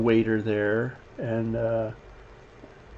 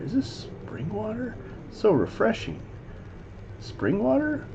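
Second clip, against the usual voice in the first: high, soft, savouring delivery; put-on customer voice; 9 semitones higher, 7 dB quieter than usual. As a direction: play an enchanted diner, pitched way up. Acting a customer savouring water.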